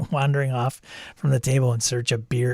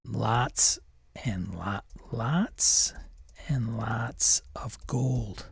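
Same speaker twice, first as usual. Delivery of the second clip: slow unvoiced whisper; 3 semitones lower, 8 dB quieter, much slower than usual. Shown as a demonstration. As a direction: whisper it while typing. Whispered while typing.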